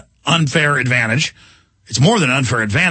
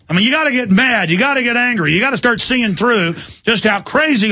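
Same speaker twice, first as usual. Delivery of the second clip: higher, heated urging; getting angry; 7 semitones higher than usual. Real, not acted. Radio host urging listeners to get mad, rising heat. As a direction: work yourself up, voice climbing. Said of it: "I'm getting angry".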